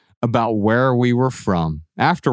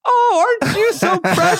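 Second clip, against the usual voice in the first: put-on very high cooing baby talk; baby talk to an adult. Fawning over a grown friend on the street, shrill baby talk. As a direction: gush at a grown man like he's a baby. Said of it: "walked up to him and said".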